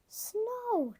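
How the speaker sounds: high excited cheer; a child's delighted shout. Acted. Peppa spotting snow, cheering high. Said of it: "cheered Peppa".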